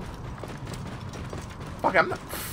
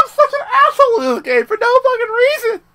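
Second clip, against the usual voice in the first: high giggling words; laughing; 8 dB louder than usual. Real, not acted. Player laughing at his own game behaviour. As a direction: laugh through the line.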